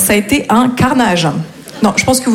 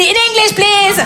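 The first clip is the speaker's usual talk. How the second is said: much higher, prissy teacher voice; prim teacher correction; 9 semitones higher, much slower than usual. Retold or imitated. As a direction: insist primly on English. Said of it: "Je lui dis".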